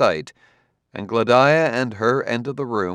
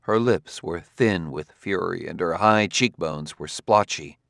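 usual speaking voice

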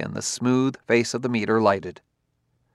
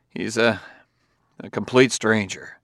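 ordinary delivery

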